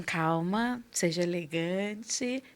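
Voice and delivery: slow, soft, composed; calm self-talk. Retold or imitated. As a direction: quietly tell yourself to calm down. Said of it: "eu me posiciono um pouco mais assim".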